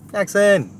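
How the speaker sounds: loud high call; calling the dog. Real, not acted. Owner calling her dog to the car, loud and high. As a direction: call him over across the yard.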